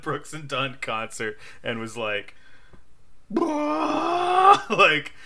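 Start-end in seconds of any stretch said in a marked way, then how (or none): 2.4-4.6 high crying laugh. Real, not acted. Laughing at own edible story.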